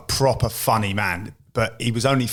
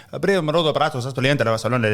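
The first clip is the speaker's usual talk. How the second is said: mock Spanish; 3 semitones higher than usual. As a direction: fake Spanish banter. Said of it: "absolute random Spanish names".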